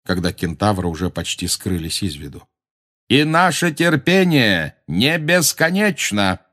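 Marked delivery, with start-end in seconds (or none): 3.1-6.4 slow, higher-pitched warning call; calling after. A narrator's reading. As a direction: call it out slowly after someone leaving. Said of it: "крикнул им вслед Магориан". (медленно крикнуть вслед)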